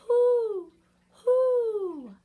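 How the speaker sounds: high, loud, hooted "who"; owl hoot. A narrator's reading. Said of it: "asked the owl".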